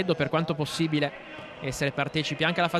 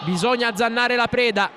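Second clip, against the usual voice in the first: shouted urging; 5 semitones higher, 4 dB louder than usual. Real, not acted. Commentator urges team on.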